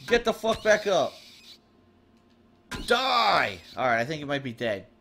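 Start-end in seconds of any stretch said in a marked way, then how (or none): 0.1-3.6 slow, drawn-out furious commands; angry yelling. Real, not acted. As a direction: snarl each word at the enemy. Yelling at enemy to stay down.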